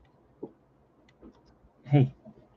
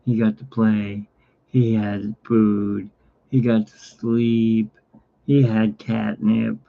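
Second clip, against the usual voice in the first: slow listing; slower than usual. Real, not acted. Listing cat's day slowly.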